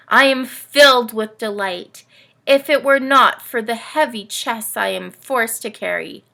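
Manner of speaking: put-on lively read-aloud for kids, camel telling its tale; storybook reading. A narrator's reading. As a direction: tell it like a bedtime picture book. Camel narrator reads story.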